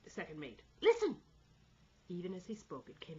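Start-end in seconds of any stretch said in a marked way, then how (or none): 0.8-1.2 very high, loud command; forceful order. A narrator's reading. Second mate to the crew, forceful order. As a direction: order it urgently at full voice. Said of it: "ordered the mate".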